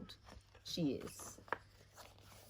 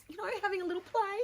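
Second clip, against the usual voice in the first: playful; much faster than usual. Real, not acted. Playful aside while painting.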